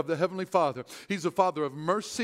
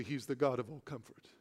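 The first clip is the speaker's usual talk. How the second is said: congregation line; 9 semitones lower, 11 dB quieter than usual. Shown as a demonstration. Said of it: "I want you to say".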